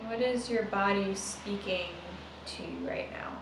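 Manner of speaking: soft, unhurried meditation guide; hushed meditation voice trailing into a whisper; guided meditation. Acted. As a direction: ease them into noticing the breath, gently.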